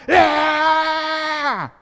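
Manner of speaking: high, full-force mob yell; riled-up crowd. Retold or imitated. Preacher acting out a crowd being stirred against Paul, riled-up yell. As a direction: roar along like an incited crowd. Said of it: "he's got 'em all riled up".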